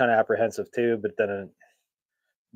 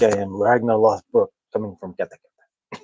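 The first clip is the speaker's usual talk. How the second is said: louder, put-on Scandinavian accent; Ragnar Lothbrok; 5 dB louder than usual. Shown as a demonstration. Man who has had Norse music and mead, playful Scandinavian accent. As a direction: slip into a hammed-up Scandinavian accent. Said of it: "a little bit of the Scandinavian comes out".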